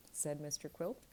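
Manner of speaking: usual speaking voice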